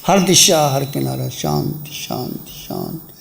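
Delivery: low meditation voice, phrases fading into silence; guided meditation. Real, not acted. Teacher guiding meditators to be still, guided meditation.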